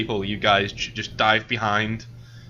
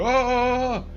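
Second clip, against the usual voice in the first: high, drawn-out "oh" of alarm; crowd's alarmed cry; 13 semitones higher than usual. Acted. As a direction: wail like a startled bystander. Bystanders reacting to flaming barrel.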